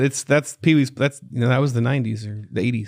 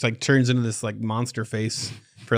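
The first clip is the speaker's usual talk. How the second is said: ordinary talk, nothing marked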